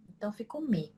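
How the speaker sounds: ordinary talk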